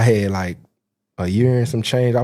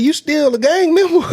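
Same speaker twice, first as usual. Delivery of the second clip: higher, louder mock-uncle question; imitating the uncle; 5 dB louder than usual. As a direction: uncle blurting his first question to his nephew, mock-stern. Uncle's blunt first question.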